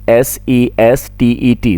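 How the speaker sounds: slow letter-by-letter spelling of the word. Shown as a demonstration. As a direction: spell out each letter slowly for the class. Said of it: "सेस्टेट".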